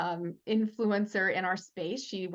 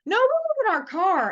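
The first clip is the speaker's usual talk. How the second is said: put-on loud, high-pitched kid's retort; blurting daughter; 12 semitones higher, 8 dB louder than usual. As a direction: imitate a child announcing it loudly. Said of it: "And my daughter goes".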